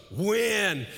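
loud, high single word; wife's word now a rallying cry; shouted. Retold or imitated. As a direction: ring it out.

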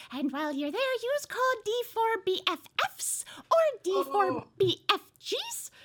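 normal talking voice

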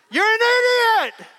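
playful hammed-up sports-fan yell; mock yell at the TV. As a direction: bellow at the screen like an armchair quarterback. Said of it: "I can yell at you through the T V screen".

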